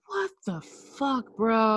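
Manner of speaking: high, drawn-out shocked what the fuck; alarmed disbelief. Retold or imitated. Reacting to a screaming friend, high shocked disbelief. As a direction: recoil in disbelief.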